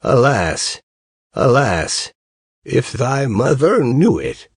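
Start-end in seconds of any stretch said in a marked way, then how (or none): none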